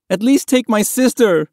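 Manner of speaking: high, loud, urgent plea; desperate begging. A narrator's reading. Boy begs strangers to save his baby sister, desperate plea. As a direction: plead at the top of your voice. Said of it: "Mahmoud begged".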